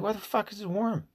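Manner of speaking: high, squeaky mock outrage; a kid baffled by the worm; hammed-up child's disbelief; disgusted kid. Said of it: "And I'm like".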